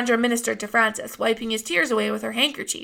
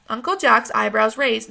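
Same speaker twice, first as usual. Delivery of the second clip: plain reading voice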